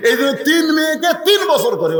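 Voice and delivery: high, emphatic preacher's cry; impassioned preaching. Acted. Father insisting on his care for three daughters, impassioned preaching.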